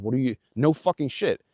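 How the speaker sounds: ordinary talk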